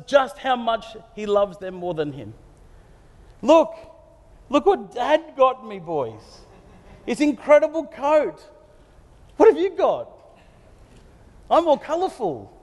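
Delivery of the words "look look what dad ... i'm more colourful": higher, showy voice of a smug younger brother; a spoiled teen bragging to his brothers about Dad's gift; bragging little brother. Retold or imitated. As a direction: show off the new coat, gloating. Boastful young Joseph to brothers.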